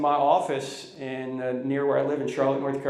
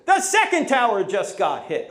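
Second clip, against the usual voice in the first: mock shout, louder and higher; retold scream; 6 semitones higher, 6 dB louder than usual. Pastor screaming into the phone, retold as a mock shout. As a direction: imitate a pastor yelling the news. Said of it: "he screams into the phone".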